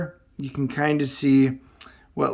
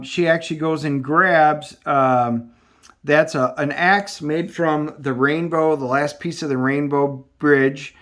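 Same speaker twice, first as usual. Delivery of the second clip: usual speaking voice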